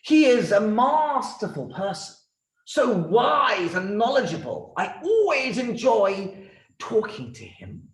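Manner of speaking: loud, high, admiring king; enthusiastic king. Acted. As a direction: praise him grandly as the king. King praises the rabbi.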